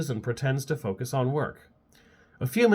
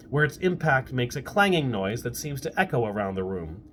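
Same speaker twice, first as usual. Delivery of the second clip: ordinary delivery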